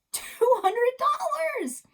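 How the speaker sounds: high, loud shout of discovery. Acted. Boy finding cash in the wall shouts it out loud. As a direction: yell it out, thrilled at the find. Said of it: "I shouted".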